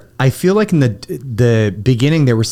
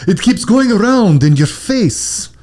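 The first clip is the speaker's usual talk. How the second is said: higher, slow, laughing fake Russian accent; mock Russian villain speech, laughing; 4 semitones higher, slower than usual. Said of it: "he's like".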